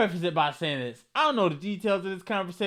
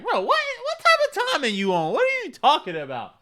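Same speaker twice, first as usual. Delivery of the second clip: put-on baffled girlfriend; 10 semitones higher, 5 dB louder than usual. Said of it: "she gonna be like".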